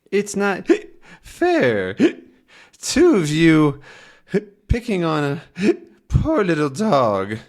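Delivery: high, laughing, wobbly whine; whiny drunk dog. Acted. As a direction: complain like a tipsy pup. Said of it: "And now Tintin says".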